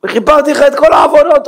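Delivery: high, emphatic proclamation; God proclaiming forgiveness. Acted. God pardoning a sinner's wrongs, high exclamation. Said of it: "אומר השם".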